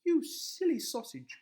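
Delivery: high, loud, slow scold; scolding wife. A narrator's reading. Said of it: "cried Missus Rose".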